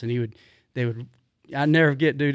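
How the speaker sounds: ordinary talk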